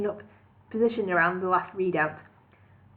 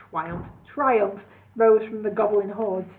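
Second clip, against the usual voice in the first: usual speaking voice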